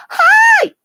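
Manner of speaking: very high, loud squeal; excited greeting. Retold or imitated. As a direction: squeal hello across the street. Calling hello to police officer.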